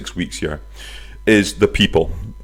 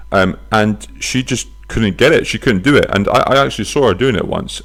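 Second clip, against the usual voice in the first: usual speaking voice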